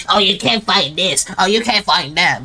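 lightly voiced whining customer; mock complaint. Shopkeeper imitating customers who can't find items, mock complaint. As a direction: imitate a picky shopper moaning. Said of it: "Don't be saying, like".

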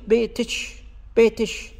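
بيتش with t-sh at the end; the kaf of بيتك turned into the ch of بيتش; kashkasha. Shown as a demonstration. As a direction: say بيتش ending in ch. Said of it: "فيكتبها تاء وشين".

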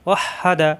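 ordinary delivery

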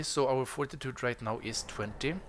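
ordinary delivery

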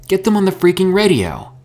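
high, loud, frantic order; shouted. A narrator's reading. Herschel shouts at Mika to radio their friends, frantic. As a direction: yell in impatient alarm. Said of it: "Herschel shouted".

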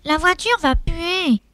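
nasal, pinched nose voice. Acted. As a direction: speak while pinching your nose. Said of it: "en se pinçant le nez".

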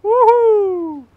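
long, high-pitched whoop; loud whoop. Real, not acted. Player cheering during the game, whooping loudly. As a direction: let out a big soaring "woo-hoo".

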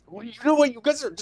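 high mock-mad squeak; imitating changed voice. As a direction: mimic a kid getting mad, pitch shooting up. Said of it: "you hear his voice change".

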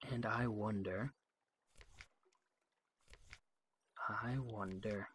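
soft, slow and wondering; musing. Real, not acted. Player musing over the diplomat, soft and slow. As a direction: muse it over quietly.

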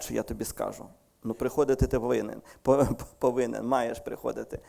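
ordinary delivery

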